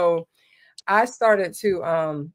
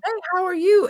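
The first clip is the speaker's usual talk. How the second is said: high, sing-song friendly greeting; high Southern greeting; 12 semitones higher, faster than usual. Retold or imitated. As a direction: greet like a sweet Southern neighbor. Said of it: "They're gonna say".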